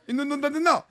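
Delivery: 있는 돈 다 냈나 with a slushy lisp, high and loud. Retold or imitated. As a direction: lisp the s sounds, laughing. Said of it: "혀 짧은 소리로".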